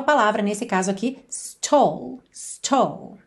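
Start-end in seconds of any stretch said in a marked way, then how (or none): none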